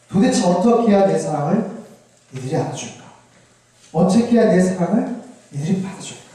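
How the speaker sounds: ordinary delivery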